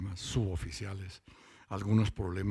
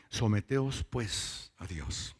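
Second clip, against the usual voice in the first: slow verse reading; much slower than usual. Real, not acted. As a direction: stretch "Someteos pues a Dios" slowly, falling. Reads verse slowly. ("Someteos pues a Dios" leído lento y solemne)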